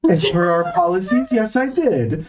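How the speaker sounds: high-pitched flustered store manager; high nervous manager voice. Acted. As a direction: play a jittery, high-voiced boss. Manager answering officer.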